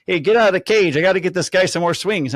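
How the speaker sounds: loud mock order; retold call-out. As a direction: imitate a pushy call across the cages. Acting coach ordering player out.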